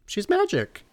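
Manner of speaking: very high, airy mock awe; starstruck awe. Host recalling meeting Julie Andrews, starstruck. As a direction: marvel in a tiny high voice. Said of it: "I was just like".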